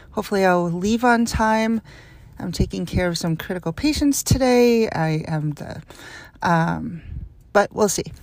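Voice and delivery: normal talking voice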